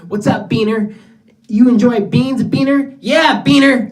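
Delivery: put-on high, loud taunting kid voice; mocking schoolkids. Imitating schoolkids taunting him, high and loud. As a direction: taunt like a sneering sixth grader. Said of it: "that's all kids would say".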